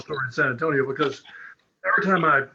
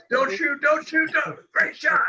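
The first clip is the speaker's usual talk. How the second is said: mock-urgent "don't shoot" squeaked out high; replaying the call with a laugh, pitched way up; 13 semitones higher than usual. To the table, retelling the Spurs shot, high and laughing.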